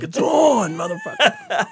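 high-pitched yelled challenge, cracking at the end; defiant shout. Retold or imitated. Jeff yelling back at the janitor, a high defiant shout. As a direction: throw down the gauntlet, way up high. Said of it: "Jeff's like".

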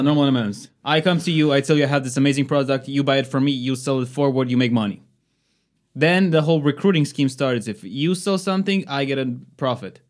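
ordinary talk, nothing marked

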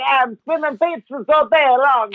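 high, hammed-up Italian pizza guy voice; mock Italian accent. Teasing cohost with a cartoon Italian accent.

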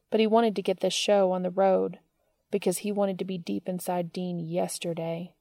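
ordinary delivery

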